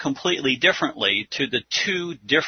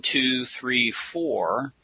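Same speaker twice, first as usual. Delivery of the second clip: ordinary talk, nothing marked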